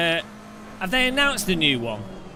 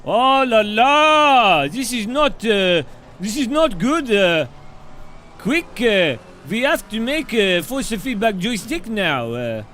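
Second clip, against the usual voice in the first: gloating cartoon German accent, higher pitch; mock German accent; 3 semitones higher than usual. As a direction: lord it over the French in a jokey German accent. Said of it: "And the Germans will be like".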